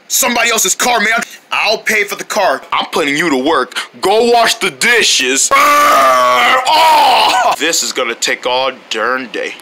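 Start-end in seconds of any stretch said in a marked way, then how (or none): 5.5-7.5 loud cartoon shiver and cry; shivering yelp. Character shivering at washing dishes.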